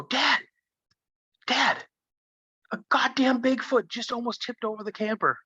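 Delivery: put-on high, frantic child's cry to dad; panicked kid. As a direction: yelp it out like a terrified boy.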